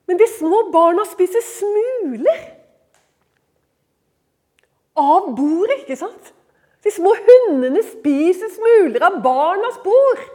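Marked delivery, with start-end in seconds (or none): 0.1-5.8 raised, louder plea; bold retort. Acted. Canaanite woman answering Jesus, bold retort. 6.8-10.2 loud, high, slow and emphatic; bold retort. Acted. Canaanite woman pleading about the crumbs, bold retort.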